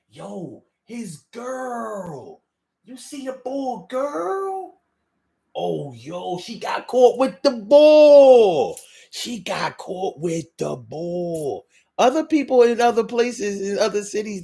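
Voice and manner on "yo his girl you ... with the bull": slow Philly whine, swelling and fading; dragged-out Philly accent. Shown as a demonstration. Host mimicking Philly gossip, dragged-out accent. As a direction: gossip in a long, whiny Philly drawl. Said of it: "don't talk like that".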